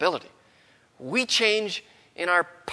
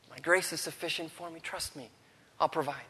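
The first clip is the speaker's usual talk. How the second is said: soft inner voice; 8 dB quieter than usual. Retold or imitated. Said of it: "A little voice right away says back".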